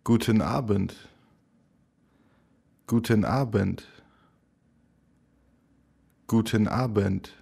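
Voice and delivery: ordinary delivery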